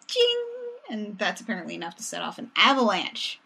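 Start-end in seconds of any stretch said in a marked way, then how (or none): 0.1-0.4 high ringing cymbal imitation; ping it high like tiny cymbals; high-pitched vocal chime; imitated cymbal ching. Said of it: "You could be like".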